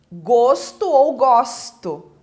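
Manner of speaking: GOSTO said twice, closed O first, then open O; two contrasting pronunciations. Shown as a demonstration. Teacher showing two readings of gosto, contrasting pronunciation. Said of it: "a pronúncia é diferente".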